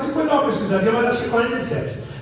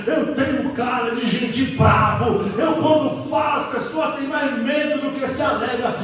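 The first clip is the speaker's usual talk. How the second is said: ordinary talk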